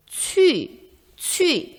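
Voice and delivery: 취 said distinctly, not as 추; model pronunciation. Shown as a demonstration. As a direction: model 취 clearly for students. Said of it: "취로 발음해야 합니다".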